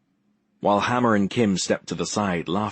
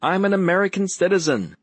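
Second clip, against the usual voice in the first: much higher, louder mock shout; mocking yell; 11 semitones higher, 4 dB louder than usual. A narrator's reading. Will yells back his friend's protest as a mocking joke. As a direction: bellow it to tease his friend. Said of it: "Will yelled".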